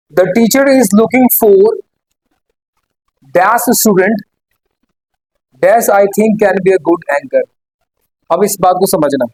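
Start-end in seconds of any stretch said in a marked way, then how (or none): 0.1-7.4 each word spaced out slowly for copying; slow dictation. Shown as a demonstration. Teacher dictating the English sentence to students, slowly.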